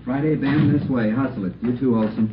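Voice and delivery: urgent call to move out now. Acted. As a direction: hurry them out the door.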